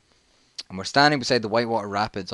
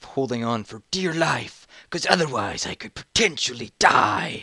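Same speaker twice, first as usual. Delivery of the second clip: mock-desperate, rising drama; melodramatic peril; 3 semitones higher than usual. Melodramatic retelling of near drowning.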